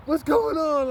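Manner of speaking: high, loud shout imitating dad; alarmed dad bursting in. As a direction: burst in yelling as the father. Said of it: "my dad comes bursting in the room".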